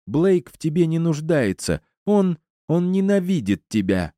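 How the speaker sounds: high-pitched, heated accusation; furious woman's high voice; angry tirade. A narrator's reading. Angry Стефани continues her quarrel.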